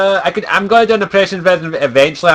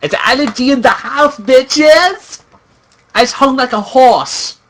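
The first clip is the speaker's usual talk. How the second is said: high, cocky gangster parody; swaggering, high-pitched gangster send-up; mock gangster; 10 semitones higher, 3 dB louder, slower than usual. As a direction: boast like a comic wannabe gangster. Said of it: "I offended the gangsters".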